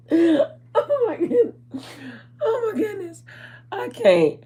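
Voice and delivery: very high squeal through real laughter; laughing, overcome. Real, not acted. Vlogger overcome by her own story, laughing helplessly. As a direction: gasp it out while cracking up.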